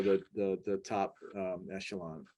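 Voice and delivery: strained and fading after a bug; just swallowed a bug. Real, not acted. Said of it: "I apologize for swallowing a bug".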